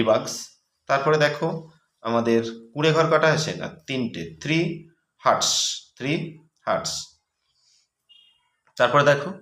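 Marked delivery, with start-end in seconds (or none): 4.4-5.8 slow and higher, spelling it out for the class; slow, careful dictation to pupils. Shown as a demonstration. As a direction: dictate three hertz word by word, slowly. Teacher dictating the answer slowly.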